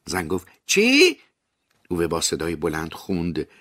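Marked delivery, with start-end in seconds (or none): 0.7-1.2 shrill, very high, loud yelp; shocked what. A narrator's reading. Journalist yelps at Ove's question, very high and loud. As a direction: squeal it in disbelief.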